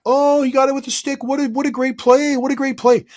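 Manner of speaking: high, sarcastic mock admiration; hammed-up sarcastic applause; mock praise. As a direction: fake cheering for a lucky play. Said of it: "There's the odd time where it's like".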